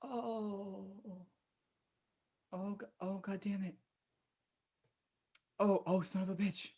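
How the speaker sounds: very soft, weak groaned cursing; barely audible agonised muttering; pained murmur. Acted. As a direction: curse feebly under your breath. Hurt, searching alone after breakdown.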